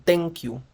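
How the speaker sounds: "thank you" starting with a hard unaspirated T; "thank you" with a Spanish T for the th; plain Spanish T. Shown as a demonstration. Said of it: "no es una T normal como en español".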